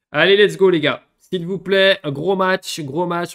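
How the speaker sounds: cheering the squad on loudly; rallying call. Real, not acted. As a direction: pump up the players. Rallying players aloud.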